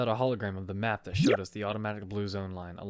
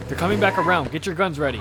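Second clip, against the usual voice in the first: loud, raised warning shout to the squad; urgent shout; 7 semitones higher, 8 dB louder than usual. Acted. Narrator warns his squad the car is returning, loud urgent shout. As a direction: bark it out as the car swings back. Car circling back for attack.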